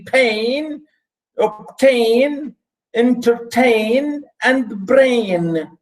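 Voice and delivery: "pain, obtain, entertain, and brain" pitched high, rhyme endings stretched; stressing the rhymes. Shown as a demonstration. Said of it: "and then B".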